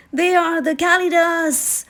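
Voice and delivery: name the danger as fear takes hold; trembling fear. A narrator's reading. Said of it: "beginning to tremble".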